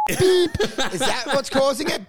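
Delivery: normal talking voice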